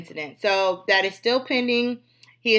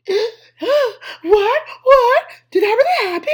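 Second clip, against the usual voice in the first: much higher, louder, stunned disbelief; mock shocked onlookers; 14 semitones higher, 7 dB louder, slower than usual. Host mimics the crowd watching: high stunned disbelief. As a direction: play a gawking bystander who can't believe it. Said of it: "Everybody just".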